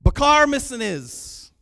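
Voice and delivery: loud, high, drawn-out hail in a foreign language; calling out. Shown as a demonstration. Speaker demonstrates overseas call to waiter, loud hail. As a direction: holler it slowly across the room. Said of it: "That's what we used to say".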